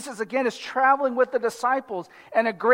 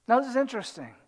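ordinary talk, nothing marked

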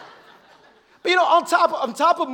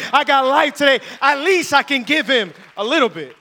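louder, driving preacher's call; preaching at full force; 4 semitones higher, 9 dB louder than usual. Acted. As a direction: rouse the church with each line.

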